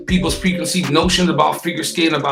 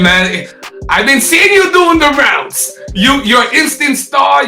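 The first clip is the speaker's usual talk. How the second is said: excited gushing; 7 semitones higher, 4 dB louder, slower than usual. Real, not acted. Host gushing over guest.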